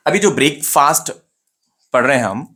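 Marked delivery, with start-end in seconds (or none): 0.3-1.1 BREAK-FAST as two full words, "ब्रेकफास्ट"; wrong pronunciation. Shown as a demonstration. Teacher shows students the common mistake, wrong pronunciation of "ब्रेकफास्ट". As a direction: say "ब्रेकफास्ट" with long "brayk" and long "faast". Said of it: "ये wrong pronunciation है उसका".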